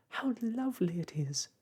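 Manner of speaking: high, delighted lady's voice; Miss Tartlet crying happily. A narrator's reading. Narrator voicing Miss Tartlet as she spins in the air, high and delighted. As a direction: gush with giddy joy, pitched well up. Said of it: "cried Miss Tartlet happily".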